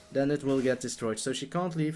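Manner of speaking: usual speaking voice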